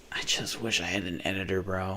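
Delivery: tired sigh of a wish; weary murmur. Real, not acted. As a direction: mumble it to yourself, worn out. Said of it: "I need help".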